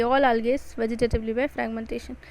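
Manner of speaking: usual speaking voice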